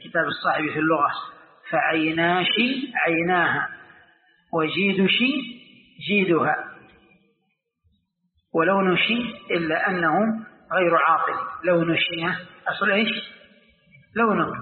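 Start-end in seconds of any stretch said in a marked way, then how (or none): 1.7-11.3 slow recitation with shi endings in "فعيناشي" and "وجيدشي"; Kashkasha verse. Shown as a demonstration. Teacher quoting the poet's example, slow Kashkasha shi endings. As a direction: recite "ولونشي" with shi in place of the k. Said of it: "لغة الكشكشة".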